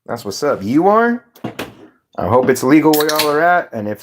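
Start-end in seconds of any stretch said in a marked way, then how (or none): 0.6-1.2 really, you are?; surprised. Real, not acted. Reacting to a chatter who is high, surprised.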